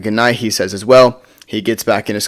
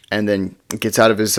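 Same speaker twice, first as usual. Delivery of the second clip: ordinary talk, nothing marked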